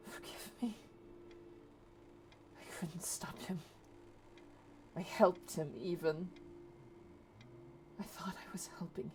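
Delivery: hushed, whispered tearful confession; acted weepy whisper, very soft; starting to cry. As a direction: whisper it brokenly, barely voiced, in halting pieces. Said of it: "start to cry".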